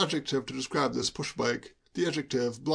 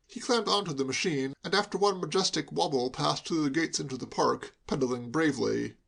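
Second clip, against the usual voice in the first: ordinary delivery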